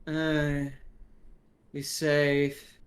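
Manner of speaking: slow, drowsy drugged mumble; slow doped-up groggy voice; stoned half-asleep. Acted. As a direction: drag the words out, barely awake. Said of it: "he's kinda half asleep".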